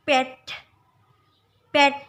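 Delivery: "pet" with a bleating open E, loud and clear; goat-bleat E. Shown as a demonstration. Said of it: "seperti suara kambing mengembik". (ucapkan "pet" dengan E seperti kambing mengembik)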